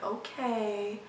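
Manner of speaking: hushed, grave "okay", like something is wrong; eerie flat tone. Retold or imitated. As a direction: say it quietly, holding back bad news. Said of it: "Again, this tone".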